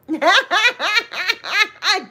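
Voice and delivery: long high laugh. Real, not acted. Teasing a friend about her Seagrams, high laugh. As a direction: crack up in high repeated bursts.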